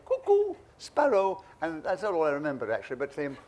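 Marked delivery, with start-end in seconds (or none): 0.1-1.4 high, slow sing-song clown call; clown's call. Retold or imitated. Clown calling to his partner in the ring, high sing-song. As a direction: mimic a circus clown calling out. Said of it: "one of them used to say".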